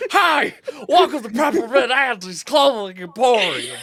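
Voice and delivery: high, loud comic greeter voice; shouted store greeter. Acted. Reading a meme aloud to friends, high shouted greeter. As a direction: bellow a goofy shop welcome, high-pitched.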